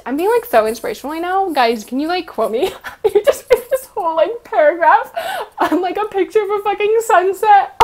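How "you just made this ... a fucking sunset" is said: high, giggly delivery; laughing. Real, not acted. Vlogger joking about being quoted as inspirational, laughing. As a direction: talk through rising laughter at your own joke.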